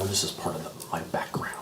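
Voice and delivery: secretive whisper. Real, not acted. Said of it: "you don't have to whisper that".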